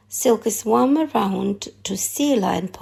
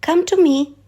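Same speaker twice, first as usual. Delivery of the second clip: higher, coaxing call to a bird; 7 semitones higher, 5 dB louder than usual. A narrator's reading. Nim coaxing the frigate bird down, gentle higher call. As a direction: lure him in gently, higher. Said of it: "she coaxed".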